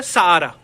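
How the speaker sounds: सहारा as "saa-raa"; mistakenly silent H. Shown as a demonstration. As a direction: leave the H out of सहारा. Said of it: "सहा-, sorry".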